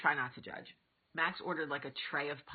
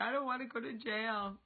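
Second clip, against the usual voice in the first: put-on high-pitched whiny kid voice; mock-scared child impression; imitating worried son; 8 semitones higher than usual. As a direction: plead like a little boy in trouble, pitched up. Voices her son afraid of jail.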